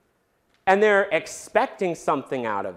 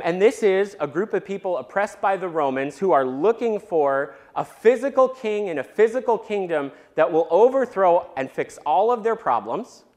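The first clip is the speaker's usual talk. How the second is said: ordinary talk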